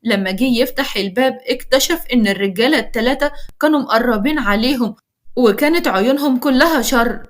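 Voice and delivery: usual speaking voice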